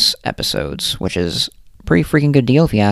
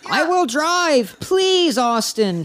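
very high, drawn-out begging; pleading; much slower than usual. A narrator's reading. Travis begging Austin to come see the goat, pleading. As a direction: plead in a high, hammed-up whine. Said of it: "Travis pleaded".

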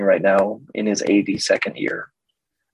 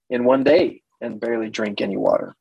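usual speaking voice

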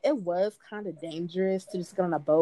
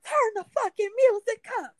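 high, loud party-guy shout; rowdy demand; 5 dB louder than usual. Retold or imitated. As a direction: yell it like a drunk on a boat. Other guy demanding music.

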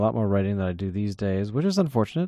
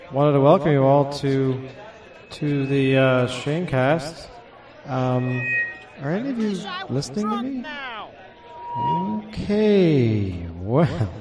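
hesitant, fumbling mic-check hello; unsure host, is anyone there; awkward greeting; 3 semitones higher, slower than usual. Acted. Nervous fake start before the show.

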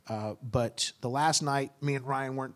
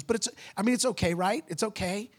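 higher-pitched plea for reassurance, retold; anxious asking; 8 semitones higher, 3 dB louder than usual. Girl asks pastor if it's okay, anxious asking. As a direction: mimic her seeking approval. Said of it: "She's asking me if it's okay".

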